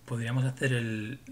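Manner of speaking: normal talking voice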